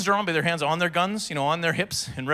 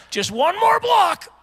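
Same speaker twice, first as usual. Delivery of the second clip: high, loud, drawn-out strained cry; straining through exercise; 5 dB louder, much slower than usual. Acted. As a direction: push out one last rep, hammed-up. Straining in a crouched driving workout.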